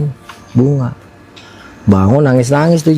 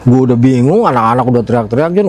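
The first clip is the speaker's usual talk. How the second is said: usual speaking voice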